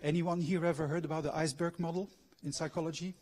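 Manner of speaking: ordinary talk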